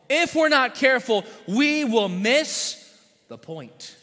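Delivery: slow, weighty warning on we will miss the point; each word of we will miss the point dragged slow; drawn-out warning. Acted. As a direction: stretch it out so it sinks in. Preacher driving home sermon title.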